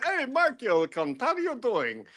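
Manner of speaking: put-on British accent. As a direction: greet a mate in a mock British accent. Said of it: "gives me shit for my British accents".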